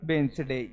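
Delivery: वेडनेसडे as two beats, 'bens-de'; silent D. Shown as a demonstration. Teacher repeating the answer for pupils, वेडनेसडे as 'wens-day'. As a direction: say वेडनेसडे with no D before N. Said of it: "क्या पढ़ेंगे".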